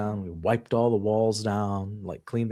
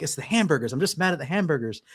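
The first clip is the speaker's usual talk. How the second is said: retold protest; 6 semitones higher than usual. Husband explains himself to frightened wife.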